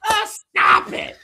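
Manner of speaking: high, loud, drawn-out mock outrage in a little girl's voice; hammed-up toddler mimicking mom's road rage. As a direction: shout it like a tiny angry driver. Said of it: "she'd go".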